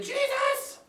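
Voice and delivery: high, panicky call; Peter's cry for help. Acted. Teacher acting Peter sinking, crying to Jesus for help. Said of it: "And he said".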